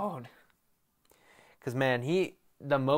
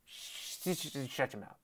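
muttered shut up; 10 dB quieter than usual. Retold or imitated. Snapping back at mocking fans.

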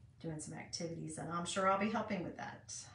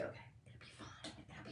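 self-reassuring whisper; 15 dB quieter than usual. Real, not acted. Reassuring self under breath.

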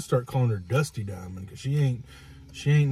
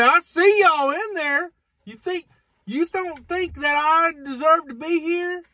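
much higher, slow, whining complaint; high whiny mock voice; slower than usual. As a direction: imitate her shrill pleading at the window.

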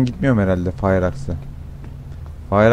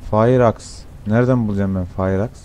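usual speaking voice

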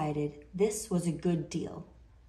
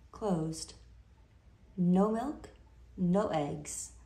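ordinary delivery, nothing marked

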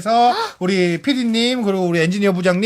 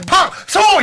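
high, loud winner call; winner announcement; 9 semitones higher, 3 dB louder, slower than usual. Acted. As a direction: shout out the winner's name.

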